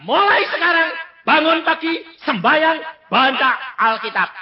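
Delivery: high, loud, firm instructions; loud commanding order. Retold or imitated. Preacher retelling the doctor's orders, loud and commanding. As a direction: bark the daily orders like a strict command.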